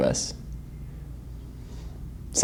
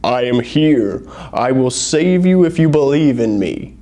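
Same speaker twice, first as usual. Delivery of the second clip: a hint of a voice from heaven; booming voice. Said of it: "a booming voice speaks up".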